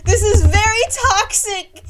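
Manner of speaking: loud, high, slow mock sob with laughter; hammed-up sobbing. [name] wailing at Twyla, hammed-up sob. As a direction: wail it like a wounded teen.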